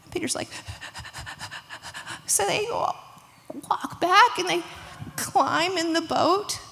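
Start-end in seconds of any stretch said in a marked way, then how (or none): 0.5-2.2 rapid voiceless shivery breaths; acted breathless huffing; frightened panting. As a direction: pant quickly, softly, as if shaken. Peter after being pulled from water.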